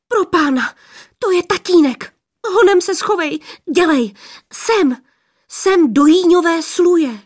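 high, loud, panicky warning; alarmed urging. A narrator's reading. Girls hurrying the stranger into hiding from father, alarmed.

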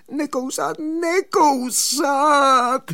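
high begging cry, the second drawn way out; pleading. Acted. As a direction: wail it as a desperate plea, stretching out the repeat. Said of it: "volal žadonivým hlasem". (žadonivé, protáhlé volání vysokým hlasem)

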